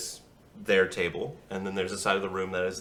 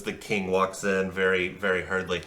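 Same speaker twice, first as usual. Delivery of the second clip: ordinary talk, nothing marked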